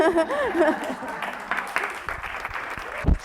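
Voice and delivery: high, breathy burst of laughter; real laugh. Real, not acted. Farmer finishing her short introduction to the audience, laughing. Laughs after closing thanks.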